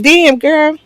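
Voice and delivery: high-pitched admiring outburst; impressed exclamation. Pretend. Imagined reaction to woman's figure.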